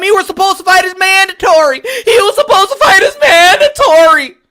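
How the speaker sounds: high, loud fake-crying whine; hammed-up sobbing complaint; mocking whiny fans. Mocking crying fans, whiny fake sob. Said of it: "bitching and crying".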